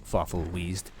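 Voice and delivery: ordinary delivery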